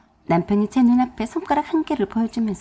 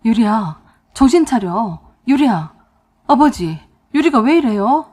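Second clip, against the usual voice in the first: loud, alarmed calling; frantic; 6 dB louder than usual. A narrator's reading. Husband rushing to fainted wife, frantic calling. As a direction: cry out in panic for an explanation. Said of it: "남편이 더 난리를 쳤습니다".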